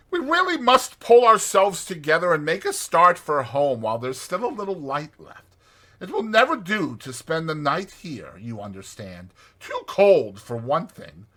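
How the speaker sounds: rally the tired friend to get moving; Rat, brisk and urging. A narrator's reading. Rat urging Mole to start for home before dark.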